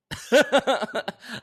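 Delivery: real laugh. Real, not acted. Laughs at own British voice.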